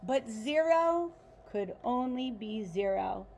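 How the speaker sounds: ordinary delivery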